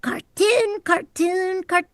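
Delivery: high, repeated cartoon-character line; high mimicked reference take; imitated playback of cartoon voice. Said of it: "they would stop right before I talked and play".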